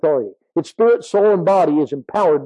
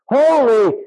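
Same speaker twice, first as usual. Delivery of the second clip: HOLY drawn out high and louder; emphatic holy; 7 semitones higher, 4 dB louder than usual. Real, not acted. Preacher exhorting the congregation to holiness, emphatic. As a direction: proclaim HOLY, pitched high. Preacher proclaiming key word.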